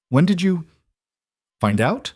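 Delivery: the question cracks high with shock; lost control. A narrator's reading. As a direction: voice jumps high, control slipping. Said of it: "lost that careful control".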